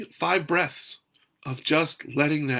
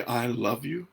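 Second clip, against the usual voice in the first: "I love you" soft and open on a free breath; relaxed, breathing "I love you"; 4 dB quieter than usual. Shown as a demonstration. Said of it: "open and relax and breathe".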